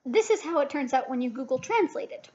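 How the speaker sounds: usual speaking voice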